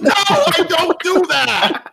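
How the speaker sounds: normal talking voice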